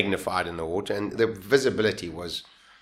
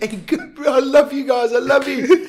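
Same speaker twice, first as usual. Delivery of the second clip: high, loud, gushing thanks; thanking the hallucinated kids, overjoyed; overjoyed greeting; 7 dB louder than usual. Retold or imitated. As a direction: burst out with relief and love. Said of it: "And I remember saying".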